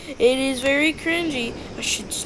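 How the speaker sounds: normal talking voice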